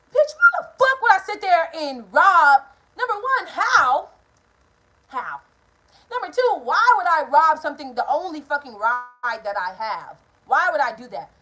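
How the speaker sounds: indignant retort. Retold or imitated. Her retort to accusing mom.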